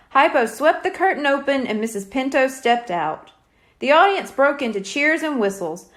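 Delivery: usual speaking voice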